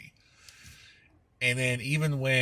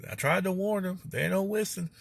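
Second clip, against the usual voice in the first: higher ominous warning, lightly voiced; slow ominous raspy drawl; 9 semitones higher than usual. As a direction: mutter a gloomy warning like a backwoods prophet. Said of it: "Eddie does the".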